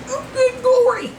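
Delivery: high-pitched exasperated cry; high exclamation. Real, not acted. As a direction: let it shoot up high as you strain. Straining exclamation while bending over.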